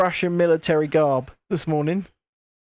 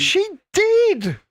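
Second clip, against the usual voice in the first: high-pitched protesting insistence; emphatic insistence; 11 semitones higher than usual. Real, not acted. Storyteller insisting after a second denial, high emphatic insistence. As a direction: push back hard, voice pitched right up.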